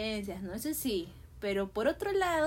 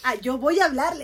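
louder, emphatic retold decision; energetic resolve; 8 dB louder than usual. Girl deciding to approach the boy she likes, energetic resolve. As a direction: announce it boldly, acting out the impulse. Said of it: "te da esta energía de que".

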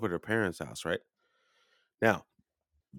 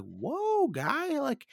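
very high, drawn-out whoa; high startled whoa; 4 dB quieter, much slower than usual. Retold or imitated. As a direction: squeak out a shocked whoa. Startled reaction to insult.

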